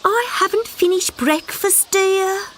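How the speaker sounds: slow high mother voice, unsure; a mum who forgets names; vague mother frog. Acted.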